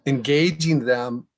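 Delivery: usual speaking voice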